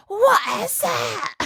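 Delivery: put-on high, slow little Scottish girl voice; mock tiny child's question. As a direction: mimic a toddler asking slowly. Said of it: "and she goes".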